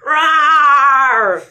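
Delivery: high, loud playful roar; mock roar. A narrator's reading. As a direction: roar it like a cartoon bear. Reader roars animal sound.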